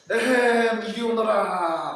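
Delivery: loud, slow lordly summons, puffed up with pride; haughty ahem. Acted. Proud elder enters the church, haughtily summoning. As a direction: call out like a proud master ordering a servant. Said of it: "그렇게 거만하게 들어오십니까".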